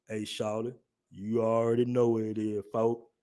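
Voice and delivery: low, soft Southern drawl. Acted. Man in a back alley down South, Southern drawl. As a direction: drop low and quiet into a menacing Southern drawl. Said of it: "you hear that sudden drawl".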